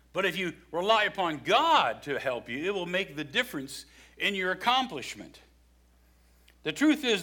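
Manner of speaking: usual speaking voice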